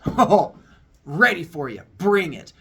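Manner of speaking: loud, bold taunt; cocky challenge. Acted. Boy facing the school bullies, loud and cocky. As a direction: puff up and dare them.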